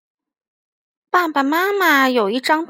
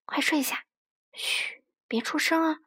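hushed, softer bedtime voice; 轻声嘘哄; 2 semitones higher, 7 dB quieter than usual. Acted. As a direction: shush a child gently under your breath. 妈妈夜里哄孩子别出声. (压低声音哄孩子)

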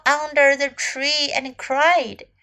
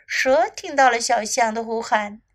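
plain reading voice

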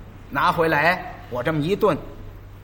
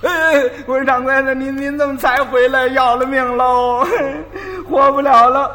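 higher, louder put-on wailing with sobs; fake crying; 6 semitones higher, 5 dB louder than usual. Apprentice faking tears to the master, hammed-up wailing. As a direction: wail like a kid faking tears. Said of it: "我，我就哭".

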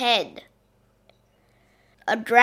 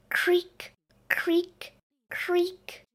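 high, breathless whispered creaking; 8 semitones higher, 6 dB quieter, much slower than usual. Acted. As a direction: whisper the creaks like an old door. Door creaking open, spooky.

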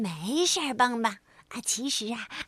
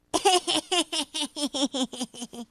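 giggling laugh. A narrator's reading. Frog giggling at the tricked rabbit, nonstop giggle. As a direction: bubbly giggle that won't stop. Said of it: "咯咯地笑个不停".

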